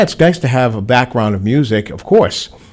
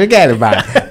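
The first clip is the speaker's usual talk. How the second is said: mock Rhode Island accent; 2 semitones higher than usual. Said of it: "the Rhode Island accent's gonna kill".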